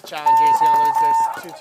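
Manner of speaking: loud, high excited call; excited shout. Real, not acted. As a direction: shout it out over the action.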